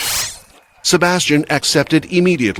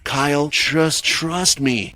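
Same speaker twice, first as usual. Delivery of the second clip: slow, steady and calm; calm and reassuring; 3 semitones higher, 3 dB quieter, slower than usual. A narrator's reading. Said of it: "Ray said calmly".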